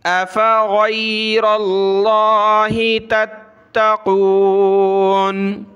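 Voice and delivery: slow melodic tilawat; Quranic recitation. Acted. Teacher recites the whole verse for students. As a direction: chant the verse slowly and evenly.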